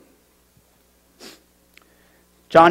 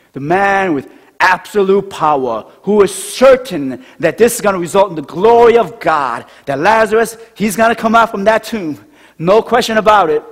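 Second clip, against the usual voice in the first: louder, building preacher's declaration; rising preaching; 4 semitones higher, 5 dB louder than usual. Real, not acted. Pastor proclaiming Christ's power, rising preaching. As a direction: build it up like a sermon peak.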